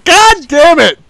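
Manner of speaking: loud exasperated curse; frustrated shout. Real, not acted. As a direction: yell it out in frustration. Frustrated outburst.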